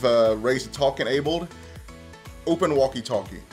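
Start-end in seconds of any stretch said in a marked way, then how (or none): none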